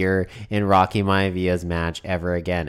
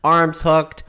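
live play-by-play; 4 semitones higher than usual. Acted. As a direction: call the slam like a wrestling announcer. Play-by-play continues.

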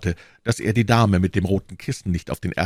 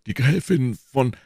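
emphatic, higher character voice; boasting Porthos; 7 semitones higher than usual. A narrator's reading. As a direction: press on insistently until cut off. Porthos goes on, gets cut off.

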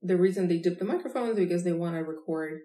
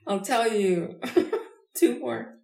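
slow words broken by giggles; can't stop chuckling while promising more; laughing; 4 semitones higher, slower than usual. Real, not acted. Giggling offer of more jokes.

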